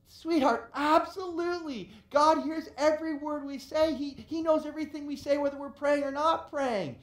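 lightly voiced father reassuring his girl; father answers his daughter, a hint of a proud dad.

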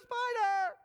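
high, loud shriek of panic; freaking out. Retold or imitated. Sister yells to mom from hallway, shrieking in panic. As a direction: scream it out in terror. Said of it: "She's freaking out".

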